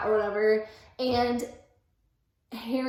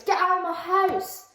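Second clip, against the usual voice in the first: angry order; 8 semitones higher, 4 dB louder than usual. Acted. Harry throwing Lily out.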